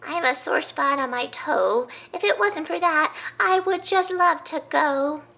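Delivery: high, whiny leopard excuse; excuse-making leopard. Acted. Leopard dodging the trip with a feeble excuse, high. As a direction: plead a sore toe.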